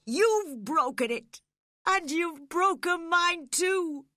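loud, high-pitched furious accusation; wild anguished shouting; wild cry. A narrator's reading. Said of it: "he cried wildly".

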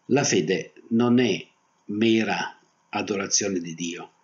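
ordinary delivery

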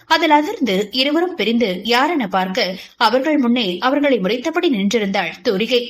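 usual speaking voice

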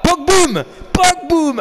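high, loud shout of the nickname; loud excited yell. Real, not acted. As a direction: blast it like a fan losing it. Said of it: "Excusez-moi pour les oreilles".

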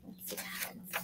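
fast excited whisper. A narrator's reading. Storyteller telling children what happens, fast whisper. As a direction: whisper it quickly like a secret. Quiet aside while Mama sleeps.

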